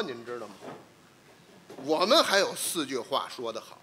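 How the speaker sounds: ordinary talk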